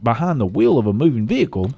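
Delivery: usual speaking voice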